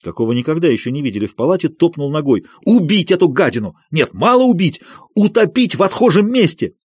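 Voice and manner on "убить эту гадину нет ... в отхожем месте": higher, building furious cry; furious rage. A narrator's reading. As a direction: rage at him, rising to a scream. Madman raging at doctor.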